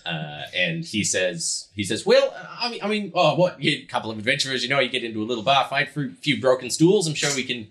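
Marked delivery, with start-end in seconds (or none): 2.1-7.7 higher-pitched, breezy innkeeper brushing it off; easygoing innkeeper. Acted. Innkeeper brushing off a bar fight to adventurers, breezy. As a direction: play down the trouble in a raised character voice. Game master plays Yuri.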